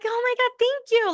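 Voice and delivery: mock-thrilled high thank-you; grateful relief. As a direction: squeal it out with relief. Stranger's grateful reaction retold.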